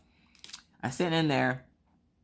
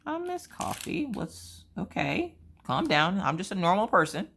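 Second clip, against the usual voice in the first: higher, slowed, sheepish mock reply; calm a fan down awkwardly; awkward reassurance; 6 semitones higher, slower than usual. Awkwardly calming an excited fan.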